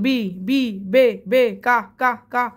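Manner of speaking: "বি", "বে", "কা" each repeated as a separate beat; syllable breakdown. Shown as a demonstration. Teacher breaking a name into syllables for students, "বি", "বে", "কা" said apart. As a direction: sound out "বি", "বে", "কা" one detached syllable at a time. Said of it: "দেখো এই যে দেখো ভাঙলাম".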